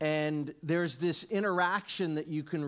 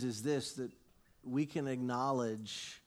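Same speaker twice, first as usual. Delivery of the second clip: usual speaking voice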